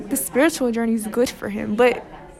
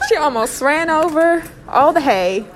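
put-on Southern accent; 3 dB louder than usual. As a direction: scold in a hammed-up Southern accent. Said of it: "we chose Southern accents".

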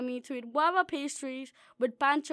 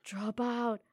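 soft hushed murmur, voices in the head; a hint of inner voices whispering; whispered voices; 6 semitones lower, 7 dB quieter than usual. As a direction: breathe it like a tempting voice. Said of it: "all the voices start whispering".

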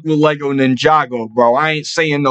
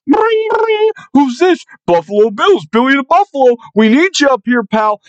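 mock phone call; 10 semitones higher than usual. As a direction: ring him up like a goofy team mascot. Acting out phone call from Bills.

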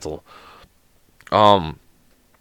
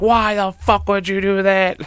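mock screaming; 9 semitones higher, slower than usual. Grandson learns grandma threw it out, mock screaming. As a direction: imitate an outraged scream at contained volume. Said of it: "screaming at her".

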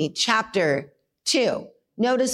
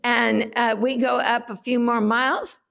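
normal talking voice

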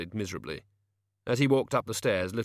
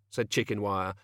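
plain reading voice

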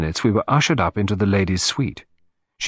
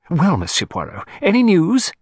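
eager, jumping high and louder at the end; eager demand; 9 semitones higher, 4 dB louder than usual. A narrator's reading. Client asking the detective for news, eager. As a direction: press eagerly for an answer. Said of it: "he demanded eagerly".

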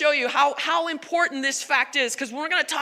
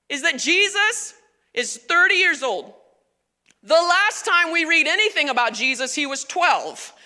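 normal talking voice

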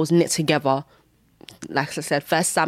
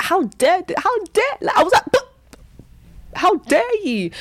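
outraged; 13 semitones higher than usual. Retold or imitated. Retelling her outrage at the enemy's dreams, very high. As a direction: shriek how dare at the enemy.

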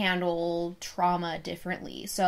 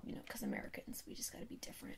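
whispered aside; 19 dB quieter, much faster than usual. Real, not acted. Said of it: "joking aside about Americans".

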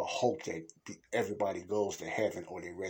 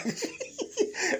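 high laugh. Real, not acted. Laughing at own new-body joke.

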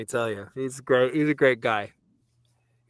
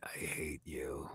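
lower, softer, slow grumble; grumpy reply; 10 semitones lower, 17 dB quieter, slower than usual. Retold or imitated. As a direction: grumble it flatly. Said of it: "I'll be like".

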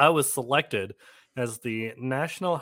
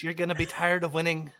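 lightly voiced Trump boast; a hint of a rally speech; Trump impression; 5 semitones higher, 5 dB quieter than usual. Mocking Trump's campaign promise.

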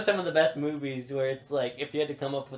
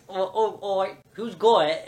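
high, louder, laughing fluster; flustered British cops; 6 semitones higher, 5 dB louder, slower than usual. Retold or imitated. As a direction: bluster like panicked officers. Police station in a panic.

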